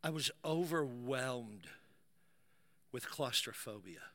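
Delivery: low, soft and halting; grave, hushed storytelling. Retold or imitated. Preacher recalling claustrophobia in the sauna, hushed and grave. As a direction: drop to a quiet, weighty confession with long pauses.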